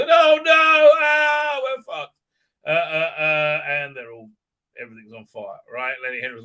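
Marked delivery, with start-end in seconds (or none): 0.0-2.2 high, loud hammed-up wail; mock panic. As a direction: wail in mock despair as homes burn. Villagers as homes catch fire.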